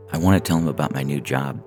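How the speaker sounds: ordinary delivery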